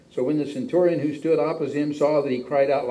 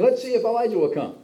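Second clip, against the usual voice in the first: mocking taunt; 10 semitones higher, 3 dB louder, faster than usual. Mocking bystanders at the cross.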